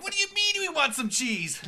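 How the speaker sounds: very high, loud mock wiseguy squawk; Joe Pesci. Co-host jokes about doing Pesci voiceover, high shouted parody. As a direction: shout it as a cartoonishly outraged mobster.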